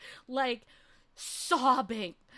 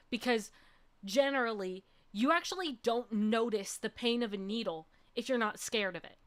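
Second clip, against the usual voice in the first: ordinary talk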